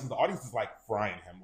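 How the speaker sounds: ordinary talk, nothing marked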